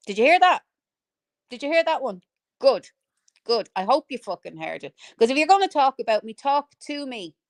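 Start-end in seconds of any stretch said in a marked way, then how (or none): none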